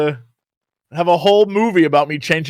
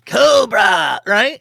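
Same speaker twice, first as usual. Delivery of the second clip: Cobra voice; 4 semitones higher, much slower than usual. Retold or imitated. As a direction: shout it like a cartoon villain. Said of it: "He's Commander Cobra".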